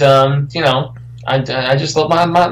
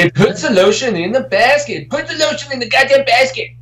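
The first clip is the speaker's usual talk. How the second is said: high-pitched creepy killer impression; light, high mock-villain voice; Buffalo Bill; 9 semitones higher than usual. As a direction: quote the movie villain, pitched up. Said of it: "that's pretty much Buffalo Bill right there".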